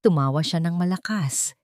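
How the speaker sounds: usual speaking voice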